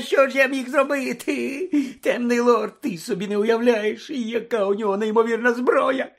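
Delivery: high, loud, tearful whine; cowardly high-pitched blubbering; sobbing Pettigrew. Acted. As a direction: wail your excuses in a squeaky, panicked voice. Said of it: "Петтігру заридав".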